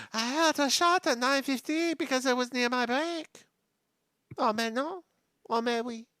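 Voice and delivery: put-on high-pitched maid with a French accent. Maid explains her break to the inspector, high French accent. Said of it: "the, the maid".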